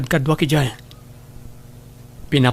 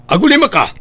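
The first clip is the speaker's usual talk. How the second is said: higher, louder furious command; angry shout; 7 semitones higher, 5 dB louder, faster than usual. Acted. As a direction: bark it at him. Heated quarrel with father.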